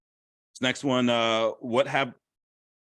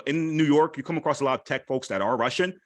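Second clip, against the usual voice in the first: normal talking voice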